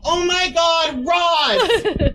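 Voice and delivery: high-pitched, drawn-out fan squeal; mock a starstruck girl on the street; gushing fan girl. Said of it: "girls come up to me on the street and go".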